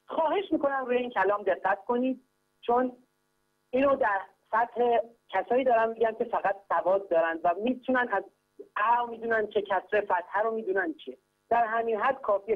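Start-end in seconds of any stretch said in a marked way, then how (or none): none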